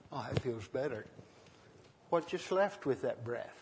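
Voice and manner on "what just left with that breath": normal talking voice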